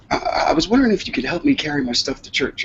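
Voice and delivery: lightly voiced grandma asking a favor; imitated grandmother request. Grandmother asks for help, retold.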